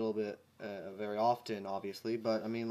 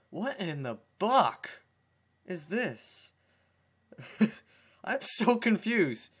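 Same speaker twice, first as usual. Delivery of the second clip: baffled; 6 semitones higher, 4 dB louder, slower than usual. Real, not acted. Baffled outburst at story.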